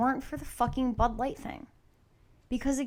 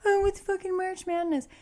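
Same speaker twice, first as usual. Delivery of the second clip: much higher, airheaded mock voice; mock ditz; 11 semitones higher than usual. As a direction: parody a ditzy airhead. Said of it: "Acting like a ditz".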